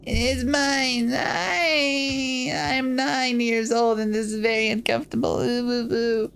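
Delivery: high fake sobbing kid; whiny child crying. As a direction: wail like a spoiled girl. Child Maria cries over candy.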